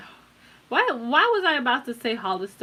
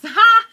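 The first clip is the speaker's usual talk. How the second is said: loud laughing ha; 13 semitones higher, 17 dB louder than usual. Real, not acted. Laughs at reading "breasts.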